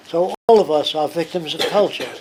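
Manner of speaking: ordinary talk, nothing marked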